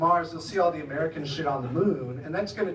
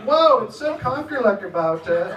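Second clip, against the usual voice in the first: louder, laughing imitation of a Dutch colleague; mock Dutch enthusiasm; 4 semitones higher, 6 dB louder than usual. Comedian aping coworkers' Dutch, laughing. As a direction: ham up the Dutch slang. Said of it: "and I'm like".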